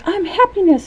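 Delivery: high-pitched gleeful smiley voice; happy little character. Acted. Smiley proudly announces his new name, high happy voice. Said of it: "he's all happy".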